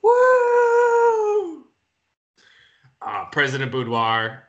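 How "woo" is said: shrill excited cheer; high woo. Acted. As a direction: whoop up high. Moderator cheers.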